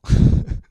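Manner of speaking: loud, breath-driven chuckle; brief burst of real laughter; short laugh. Real, not acted. Laughing at dumb tastes of younger days, short laugh.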